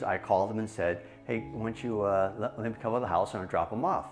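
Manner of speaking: ordinary talk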